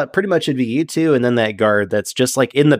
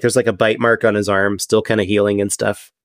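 usual speaking voice

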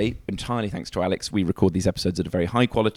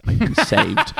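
relieved; 13 semitones higher, 5 dB louder, slower than usual. Retold or imitated. Relief at hearing the edit.

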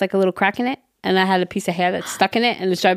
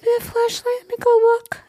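put-on concerned boyfriend; 13 semitones higher than usual.

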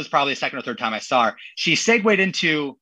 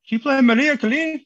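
higher, imitating his wife's Yucatán Spanish accent; Mexican accent; 10 semitones higher, 3 dB louder than usual. Wife at the DJ booth requesting a song, in a Mexican accent. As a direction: sweetly mimic a Mexican accent asking for a song. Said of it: "the impersonation is great".

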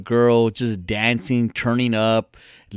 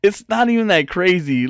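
calming him; 6 semitones higher, 3 dB louder than usual. Retold or imitated. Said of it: "so I'm like".

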